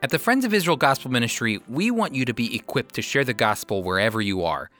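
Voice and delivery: usual speaking voice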